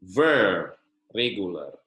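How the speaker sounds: ordinary talk, nothing marked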